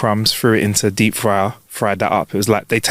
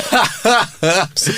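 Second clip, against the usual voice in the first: ordinary talk, nothing marked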